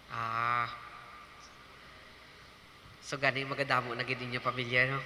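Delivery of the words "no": usual speaking voice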